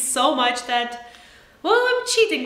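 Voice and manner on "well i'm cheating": playful mock guilt. Real, not acted. Mock confession, high.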